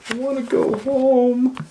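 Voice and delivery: high, loud plea; wailing. Acted. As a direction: cry out like a frightened child. Kate wails.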